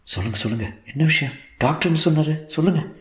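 agitated pleading, pitch climbing; anxious. Acted. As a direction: press urgently for an answer, voice rising. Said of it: "பதற்றத்துடன் கேட்டான்". (பதற்றத்துடன்)

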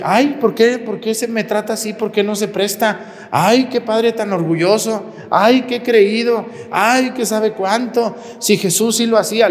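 high-pitched whiny imitation of a woman; mock indignant complaint. Offended woman scolds the priest, mock indignant.

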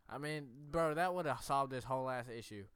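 loud, fired-up ranting; tipsy bar argument. Real, not acted. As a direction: rant it loud and laughing. Heated stoned argument.

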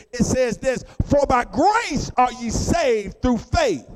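building sermon cadence with drawn-out key words; preaching. Acted. Preacher to the congregation, full sermon cadence.

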